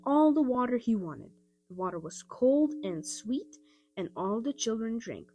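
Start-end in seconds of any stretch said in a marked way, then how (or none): none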